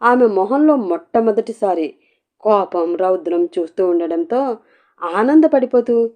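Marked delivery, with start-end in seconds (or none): none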